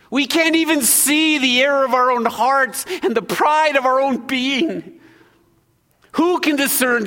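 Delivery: high, loud preacher's cry; fervent preaching. Acted. Pastor urging the congregation on our blind pride, fervent and loud. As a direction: drive it home to the congregation.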